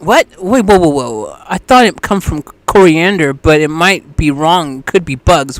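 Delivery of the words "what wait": ordinary talk, nothing marked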